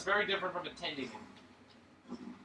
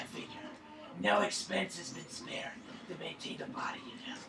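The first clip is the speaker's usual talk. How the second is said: breathy whispered vigilante voice; whispered Batman parody; 5 dB quieter than usual. Pretend. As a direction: brood in a hushed, mock-serious voice.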